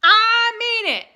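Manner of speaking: loud high threat, slow; mean every word, full volume; threatening shout. Acted. The boy threatens the tornado with a loud shout. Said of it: "he threatened".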